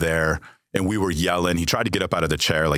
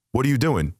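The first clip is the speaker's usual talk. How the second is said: pitched higher, pressing for an answer; repeated question, raised; 8 semitones higher than usual. Retold or imitated. Son presses ignoring father with a raised repeat. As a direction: ask it again up high, getting impatient.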